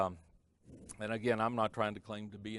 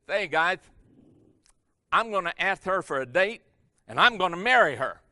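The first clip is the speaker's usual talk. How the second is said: higher, louder bragging announcement; excited boast; 5 semitones higher, 10 dB louder than usual. Retold or imitated. Joe bragging to his classmates about the campus beauty. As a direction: call it out big and sure of himself.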